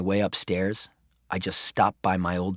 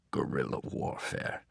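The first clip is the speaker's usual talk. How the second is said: amused murmur; 7 semitones lower, 8 dB quieter than usual. A narrator's reading. Grandpa leaving the room, muttering with quiet amusement. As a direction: lower and softer, almost breathed. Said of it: "he said on the way out".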